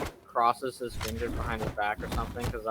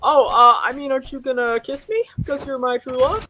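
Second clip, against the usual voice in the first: put-on much higher girlish voice, louder start; girly Fiona; 12 semitones higher, 7 dB louder than usual. Fiona asking Shrek for a kiss, high girly voice. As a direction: gush it like a fairy-tale princess. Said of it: "it's like".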